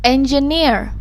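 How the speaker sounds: engineer with the last syllable, -neer, stretched and stressed. Shown as a demonstration. Teacher showing learners syllable stress in "engineer", stressed on -neer. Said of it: "louder, longer, and higher in pitch".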